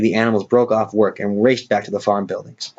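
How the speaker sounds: plain reading voice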